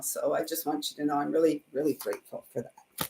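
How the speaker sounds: holding back tears while thanking; choked up. Real, not acted. Thanking her viewers, choking up near tears. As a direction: voice sinking low and soft, close to tears. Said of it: "And I don't wanna cry".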